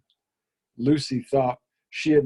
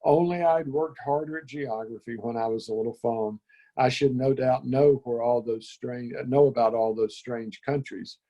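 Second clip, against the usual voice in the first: plain reading voice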